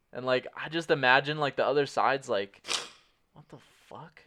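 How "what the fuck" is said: hushed, baffled mutter; barely audible, imitating a bewildered opponent; whispered bewilderment; stunned disbelief. Imagined baffled opponents reacting.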